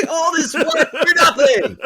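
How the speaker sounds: high, slow, laughing complaint; laughing at himself over the diet; laughing lament. Real, not acted. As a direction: laugh at your own wasted effort. Laughing self-mockery.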